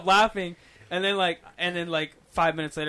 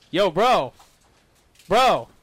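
loud call across the mic; louder, trying to get his attention; calling to a stoned teammate over the mic; 2 semitones higher, 7 dB louder, much slower than usual. Retold or imitated. Calling friend to jump.

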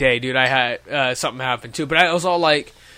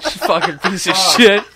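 laughing curse; 5 dB louder, slower than usual. Real, not acted. Mock insult, laughing.

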